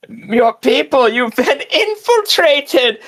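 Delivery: high, drawn-out mock proclamation; soapbox orator. Game master voices two characters on a soapbox, mock alarm. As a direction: rouse the crowd with a hammed-up public warning. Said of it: "get up on the soapbox and begin".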